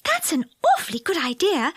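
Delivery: high, bright delighted fairy voice; Silky delighted at the plan; joyful. A narrator's reading. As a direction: sound thrilled with the idea. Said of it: "said Silky joyfully".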